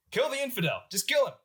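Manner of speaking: high-pitched mock battle cry; rallying cry. Religious official springs forward at the general, rallying cry. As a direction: incite the mob, pitched well up. Acted rioter incites the mob.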